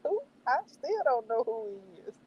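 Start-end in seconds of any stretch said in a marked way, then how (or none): 0.0-0.2 high, fast baffled squeal; high confused squeal at an unknown character; confused who-is-that. Retold or imitated. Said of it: "I was like".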